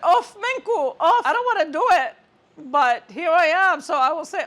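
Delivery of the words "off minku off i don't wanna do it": laughing, higher mock protest; fed-up groan. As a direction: whine that you don't want to do it. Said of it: "I was like".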